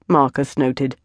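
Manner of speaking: ordinary delivery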